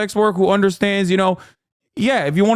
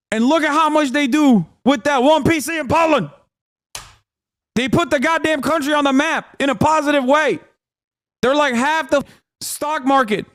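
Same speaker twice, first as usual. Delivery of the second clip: higher, fired-up shouting; passionate rant; 6 semitones higher than usual. Real, not acted. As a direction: rave about them at full pitch. Passionate rant about Poland.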